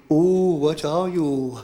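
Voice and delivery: low, drawn-out ooh; demonstrated catchphrase. Shown as a demonstration. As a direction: model the line slowly and low. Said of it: "you gotta say to me".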